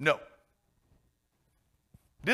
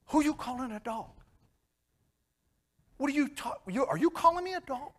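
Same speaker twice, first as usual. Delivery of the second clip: mock indignation; 9 semitones higher than usual. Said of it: "I might have had some harsh words".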